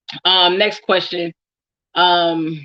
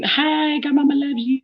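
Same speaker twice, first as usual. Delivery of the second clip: cute greeting voice; 8 semitones higher than usual. Acted. Greeting her godchildren.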